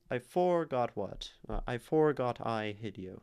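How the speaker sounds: "I forgot" with the weight wrongly on "for"; misplaced stress. Shown as a demonstration. Teacher showing that the line doesn't work as iambic, misplaced stress. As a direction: push the beat onto "for" in "forgot". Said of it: "it doesn't work".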